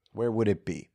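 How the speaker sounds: quietly pondering it; musing repeat. Real, not acted. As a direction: mull the question over under your breath.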